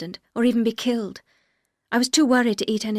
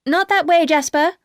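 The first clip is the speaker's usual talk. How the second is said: higher and louder call across the rocks; 7 semitones higher, 8 dB louder than usual. A narrator's reading. Woman calls her dog on the rocks, loud call. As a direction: call the dog back from a distance. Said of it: "I called".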